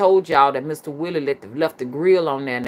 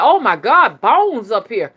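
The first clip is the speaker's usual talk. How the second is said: loud, high exclamation of alarm; acted-out shock, raised and forceful; retold shocked cry; 7 semitones higher, 7 dB louder than usual. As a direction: call it out as if just spotting it. Acts son's shocked cry.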